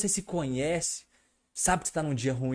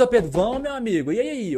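higher, louder pushy nudge to get moving; nagging partner; 8 semitones higher, 6 dB louder than usual. Retold or imitated. Retelling how his partner nags him to get moving, pushy and higher. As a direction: hound him like an impatient coach. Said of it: "É ficar me xingando".

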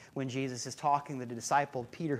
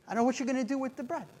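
rushed mock ramble; 7 semitones higher, much faster than usual.

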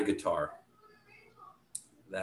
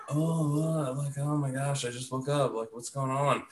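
higher, mock groggy innocence; feigned just-woke-up; 6 semitones higher than usual. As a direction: play dumb like you just woke up. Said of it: "and I was like".

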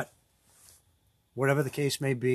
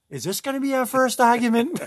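pitched way up, anxious teasing; high mock worried quote; 9 semitones higher, 4 dB louder than usual. Teasing his buddy by quoting him, high mock worry. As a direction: squeak it out like a nervous friend on the ice. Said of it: "And then all day on the ice".

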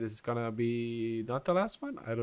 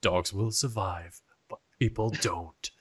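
trailer voice; 3 semitones lower than usual. Shown as a demonstration. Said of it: "let me do the trailer voice".

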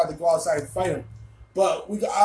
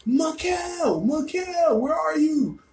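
high, drawn-out call for someone lost; 10 semitones higher, much slower than usual. Retold or imitated. Searching the streets for his friend, high calling out. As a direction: re-enact hollering a name down the street.

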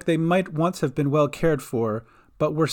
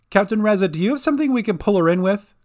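calling out; 6 semitones higher, 4 dB louder, faster than usual. A narrator's reading. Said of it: "called Gran".

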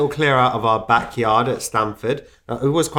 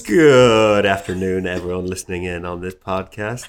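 smooth radio presenter voice; 3 semitones lower than usual. Pretend. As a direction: glide through it like a late-night radio host. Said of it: "his very smooth radio presenter voice".

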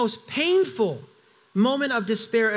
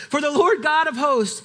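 higher and louder, pointedly impatient; exasperated repeat; 6 semitones higher, 4 dB louder than usual. Acted. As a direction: say it again as if God wasn't listening. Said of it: "because obviously you were listening to me the last time".

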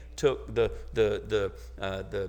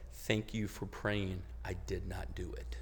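tearful whisper; 5 semitones lower, 10 dB quieter than usual. Retold or imitated. Retelling the young lady's thanks to the church, a tearful whisper. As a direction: voice a young woman whispering through her tears. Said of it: "and just through her tears".

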